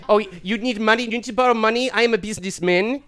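loud put-on South African accent; South African businessman. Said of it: "this is his audition".

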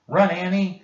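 high, loud urgent shout; crying out. Acted. Jack warns Annie to flee the jaguar, loud cry. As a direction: yell at her to run now. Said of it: "cried Jack".

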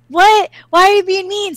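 high-pitched, indignant little-sister voice; hurt protest. Retold or imitated. Sister to brother threatening to leave her, hurt protest. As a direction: imitate a sister whining back at her brother.

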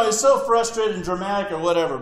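ordinary talk

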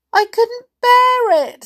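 high, slow, tearful wail; Peggy starting to cry as the panic hits; beginning to cry. A narrator's reading. Frightened girl begs the witch, near tears. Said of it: "beginning to cry".

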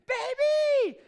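put-on a huge, high, loud Baby!; exuberant shout. Wife greeting her husband on FaceTime, thrilled shout. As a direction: call out like an excited wife. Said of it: "real loud and, exuberant and animated".

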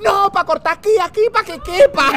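high, frantic punchline voice; hammed-up pleading cry. Screamer answering the other man, high frantic cry. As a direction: keep the shriek in your voice as you explain.

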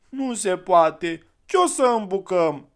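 put-on high, loud, slow child's protest; the eldest refusing to throw away the clay; boy's protest. A narrator's reading. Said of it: "făcu grav cel mare".

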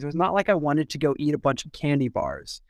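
ordinary talk, nothing marked

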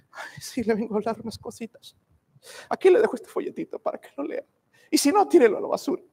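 mock timid witness, whispered start then high and hurried. Preacher mocking fearful Christians witnessing, hurried timid voice. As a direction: hand over the tract shyly and rush off. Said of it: "Con miedo".

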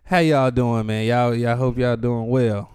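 low, slow pastor greeting. Acted. Cohost greeting listeners like a pastor, low and slow. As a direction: greet the flock gently.

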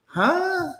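high, rising disbelief; baffled foreigner. Retold or imitated. Foreign friends reacting to American trends, high disbelief. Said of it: "are like".

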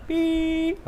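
squeaky high beep; a high little beep to show how; high beep demo. Shown as a demonstration. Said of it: "can you hum something".

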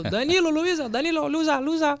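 high-pitched imitation of a man calling passengers; mock roll-call holler; calling out names. Man arrives calling their names.

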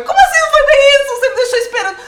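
loud, high outraged laughing shout; indignant shout. Retold or imitated. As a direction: yell it in disbelief. (grito indignado rindo)

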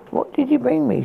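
put-on drunken slur; slurred. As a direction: slur the words, woozy. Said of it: "she slurred".

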